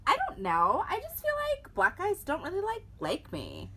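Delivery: imitating a Valley Girl. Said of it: "in her best Valley Girl voice".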